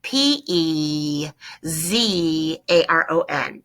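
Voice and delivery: letters spelled very slowly one by one; slow spelling. Shown as a demonstration. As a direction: dictate each letter slowly. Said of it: "So we want empezaron".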